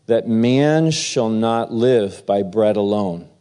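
ordinary talk

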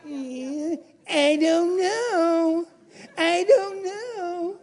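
slow, high whiny "I don't know"; whimpering mock doubt; mock doubter. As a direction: drag it out like a helpless skeptic. Mocking doubter again.

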